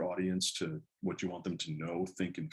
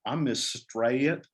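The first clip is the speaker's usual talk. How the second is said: slow, stretched Southern drawl, a bit higher and louder; long Southern drawl; 5 semitones higher, 5 dB louder, much slower than usual. Retold or imitated. Southern teacher introducing herself to the class, in a slow stretched Southern drawl. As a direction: imitate a Southern teacher stretching her name out slowly. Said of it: "in the longest Southern drawl".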